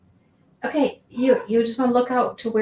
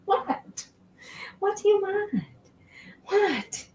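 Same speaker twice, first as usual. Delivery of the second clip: pet talk; 11 semitones higher, 5 dB quieter, slower than usual. Real, not acted. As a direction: coo at the cat what it wants. Asking the cat what it wants.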